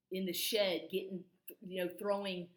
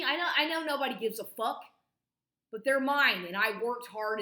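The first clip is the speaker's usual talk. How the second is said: suddenly higher and louder, heated rant; angry outburst; 5 semitones higher, 10 dB louder than usual. Real, not acted. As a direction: shout it out, fed up and defensive. Ranting about his paintings.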